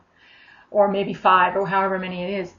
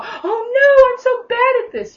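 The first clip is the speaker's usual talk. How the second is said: much higher, louder squeal of dismay; mock panic; 14 semitones higher, 7 dB louder than usual. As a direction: pretend to fall apart in a comic fluster. Mocking a panicked minister.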